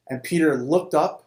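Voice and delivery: ordinary delivery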